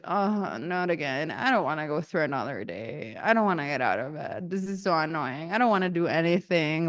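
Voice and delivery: low, soft, listless grumbling; weary dread. Retold or imitated. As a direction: grumble as if unwilling to get up. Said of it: "how a lot of the times I went through my life".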